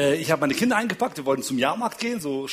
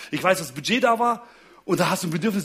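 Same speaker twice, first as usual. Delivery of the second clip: ordinary talk, nothing marked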